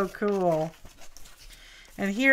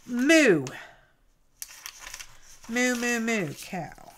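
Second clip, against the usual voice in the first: playful moo; slower than usual. Pretend. Mooing at a cow picture.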